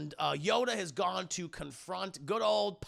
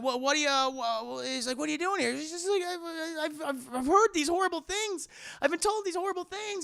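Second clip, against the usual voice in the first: high mocking voices; 9 semitones higher than usual. Acted Anakin and Padme quarrel.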